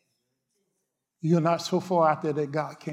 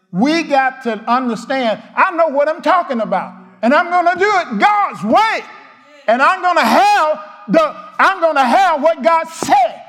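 loud, high, shouting preacher; ringing pulpit cry, rising; preaching at full force; 9 semitones higher, 8 dB louder than usual. Real, not acted. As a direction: build it up into a fervent shout.

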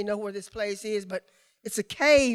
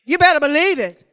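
loud preacher's punch; exhorting; 5 semitones higher, 14 dB louder than usual. Real, not acted. Preacher exhorts the congregation to believe, punching it loudly. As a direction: drive it home hard.

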